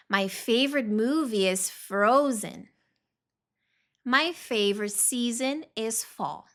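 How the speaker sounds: slow, clear model reading for learners; pronunciation drill. Shown as a demonstration. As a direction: read each line slowly so the class can repeat. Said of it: "Vamos ler junto só pra praticar a pronúncia". (leitura lenta e clara para a turma repetir)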